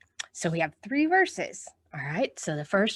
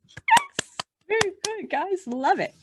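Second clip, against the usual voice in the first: high, bright yes and praise; excited praise; 12 semitones higher, slower than usual. Real, not acted. Teacher praising children, excited. Praising kids after song.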